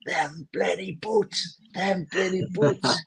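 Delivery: high, drawn-out mock yell, held back in volume; imitated shouting. Teammate retelling a player's touchline rant, imitated shouting. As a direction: stretch each word like a strained, far-carrying holler. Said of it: "he was shouting for five minutes".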